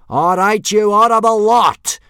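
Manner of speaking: high, very loud sergeant-style bellow; yell. A narrator's reading. Bad-tempered instructor to the boys, yelling. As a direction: bellow at a rabble of recruits. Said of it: "yelled Gobber".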